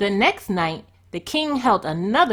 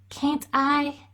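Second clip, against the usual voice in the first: higher, small pleading voice; meek girl's question; timid Cinderella; 6 semitones higher than usual. A narrator's reading. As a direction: ask shyly, pitched up. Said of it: "she said".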